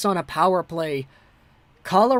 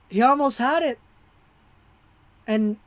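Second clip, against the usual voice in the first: ordinary delivery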